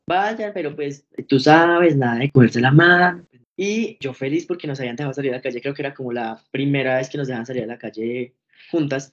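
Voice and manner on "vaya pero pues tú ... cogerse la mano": slow start, then loud warning; imitating mom laying down the rules; mock stern mom warning. Mom warns her daughter before she goes out, in a loud, stern voice.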